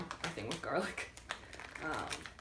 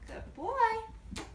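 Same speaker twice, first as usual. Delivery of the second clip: high praise for dog. Real, not acted.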